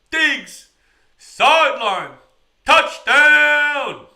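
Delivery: high, loud broadcaster yell, word by word; reliving the Miracle call at full shout; shouted touchdown call. Retold or imitated. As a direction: belt it like the famous radio call. Reliving the famous Minneapolis Miracle call.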